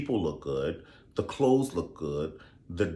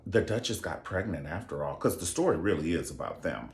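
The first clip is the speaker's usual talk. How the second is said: ordinary talk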